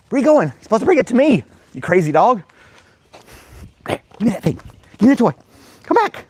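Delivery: high, loud playful calling; excited high dog talk. Real, not acted. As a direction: chase the dog with your voice. Dog runs off with toy.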